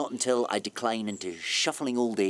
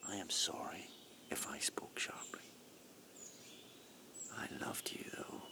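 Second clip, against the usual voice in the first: whispered, much softer apology; contrite whisper; 2 semitones lower, 16 dB quieter than usual. Acted. Man whispering an apology to her after shouting. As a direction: drop to a hushed, ashamed whisper.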